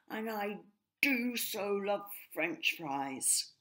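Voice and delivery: a hint of a jealous, scoffing brother; scornful rook. A narrator's reading. Reggie complains about his brother.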